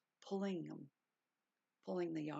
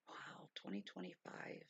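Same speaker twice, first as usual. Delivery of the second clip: awed whisper; 9 dB quieter than usual. Real, not acted. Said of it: "awed at new year".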